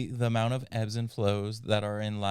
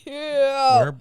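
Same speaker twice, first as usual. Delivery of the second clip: loud, very high burst; mock outcry; 10 dB louder than usual. Mock tearful sympathy, bursts in.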